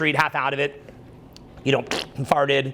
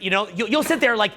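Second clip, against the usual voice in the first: usual speaking voice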